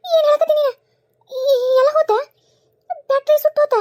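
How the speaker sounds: loud, high-pitched horrified questions; aghast, in short outbursts; shocked disbelief. Acted. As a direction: cry out in stunned disbelief. Mother-in-law shocked by the news.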